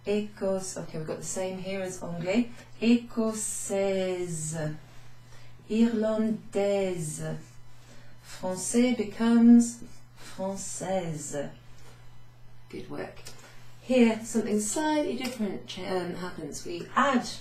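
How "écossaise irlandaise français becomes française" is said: slow model reading. Shown as a demonstration. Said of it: "slow model words".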